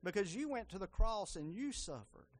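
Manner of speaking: earnest spoken prayer to Jesus. Acted. Preacher leading the church in prayer, earnest.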